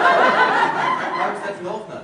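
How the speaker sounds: loud, high, drawn-out laughing cry; excited outburst. Acted. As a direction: blurt out her name in nervous hope. Nervous suitor bursts out hopefully.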